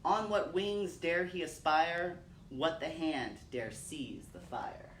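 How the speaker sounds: slow, grave poem reading with long pauses; solemn recitation. Acted. Reciting William Blake for her son, solemn measured recitation. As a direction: let each question hang in the air.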